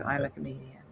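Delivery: low, soft deadpan; dead serious. Retold or imitated. Comedian answering a doctor about work, softly deadpan. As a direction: say it quietly with utter seriousness. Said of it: "in the most serious face I can muster".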